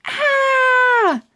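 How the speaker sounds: sharp high scream of fright. Acted. Kids startled by a hand scream. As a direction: shriek as the hand grabs out. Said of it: "they screamed".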